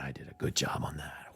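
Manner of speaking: whispering to yourself in front of the card; awed whisper. Retold or imitated. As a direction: breathe it out in hushed awe. Admiring own drawing.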